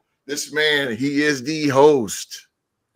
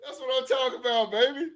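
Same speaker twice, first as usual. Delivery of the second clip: high fast hype shout; hyped shout; 10 semitones higher, 3 dB quieter, much faster than usual. Real, not acted. Host hyped after the intro, high fast shout. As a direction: fire it off quickly, pumped.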